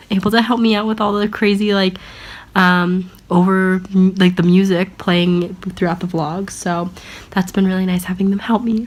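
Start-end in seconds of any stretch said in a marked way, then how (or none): none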